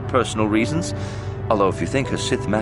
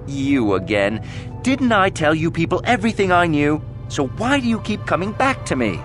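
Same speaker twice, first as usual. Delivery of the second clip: fed-up, higher-pitched complaint; exasperated; 7 semitones higher than usual. Acted. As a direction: protest at being questioned yet another time. Witness fed up with repeat questioning.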